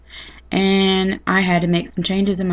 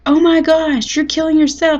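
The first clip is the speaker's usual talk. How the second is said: alarmed mom; 9 semitones higher than usual. Retold or imitated. Terrified mom quoted.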